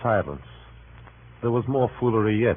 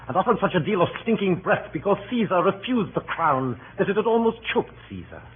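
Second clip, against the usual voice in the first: mounting scorn; 11 semitones higher than usual. Acted. Casca mocks the crowd's roar.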